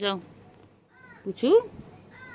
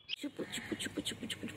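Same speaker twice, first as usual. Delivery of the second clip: soft whispered pet name; whispering; 24 dB quieter, faster than usual. Real, not acted. Owner whispering lovingly to her cat Puchu. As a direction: whisper the name close and gently.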